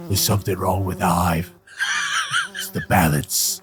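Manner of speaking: lightly voiced tough action hero; a hint of a gruff movie star; Jason Statham. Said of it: "That's a pretty good Jason Statham".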